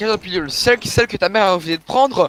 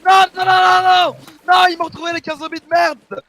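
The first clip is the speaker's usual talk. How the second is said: high, loud repeated cries of no; panicked, louder and higher gamer outburst; 8 semitones higher, 6 dB louder than usual. Real, not acted. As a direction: cry out in alarm as the attackers find you. Zombies find him again in game.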